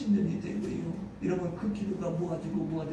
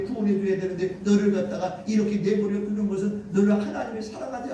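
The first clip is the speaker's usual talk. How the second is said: higher, forceful tempter's harangue; imitated devil's taunting; 6 semitones higher, 5 dB louder than usual. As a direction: press doubt on them as Satan. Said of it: "귀에 속삭이는 거예요". (사탄이 몰아붙이는 말투 흉내)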